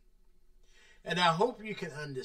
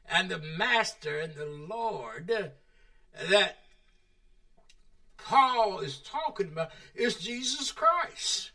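ordinary talk

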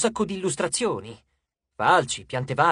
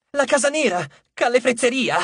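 high frantic dwarf voice; 9 semitones higher, 4 dB louder than usual. A narrator's reading. Dwarf blurts the address.

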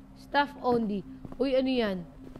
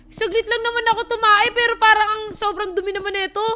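louder exasperated complaint; 6 semitones higher, 7 dB louder, faster than usual. Real, not acted. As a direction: protest the mess in disbelief. Complaining about mess.

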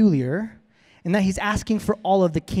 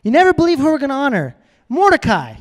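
excited king; 6 semitones higher, 3 dB louder than usual. Pretend. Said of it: "the king's all".

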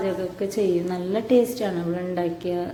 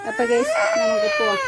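crying; 3 dB louder than usual. Real, not acted. Crying child copies mom's intro.

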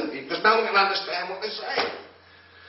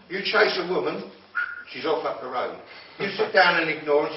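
ordinary delivery

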